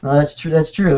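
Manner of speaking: ordinary talk, nothing marked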